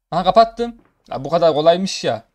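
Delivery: loud, triumphant cry of relief; excited shout. Real, not acted. Finally turned off the TV.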